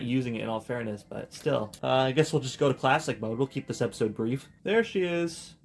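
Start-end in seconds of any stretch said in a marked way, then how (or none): none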